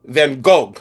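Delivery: Gogh as "goag", ending in a hard g; other people's pronunciation. Retold or imitated. Seller puzzled by others' version, Gogh as "goag". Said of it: "have you ever heard it pronounced".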